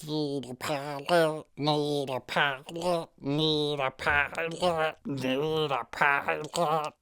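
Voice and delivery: slow Southern drawl on pilot, repeated; Southern accent drill. Shown as a demonstration. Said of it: "needs a dialect lessons".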